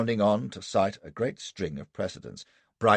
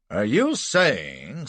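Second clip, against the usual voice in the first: very slow probing question; 5 semitones higher, 5 dB louder, much slower than usual. Acted. Lawyer presses witness, slow.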